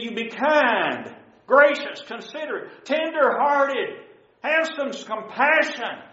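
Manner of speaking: high, slow scripture exhortation; stately sermon cadence with falling phrase ends; impassioned preaching. Real, not acted. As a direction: draw out each virtue, pitch lifted. Preacher recites list of virtues.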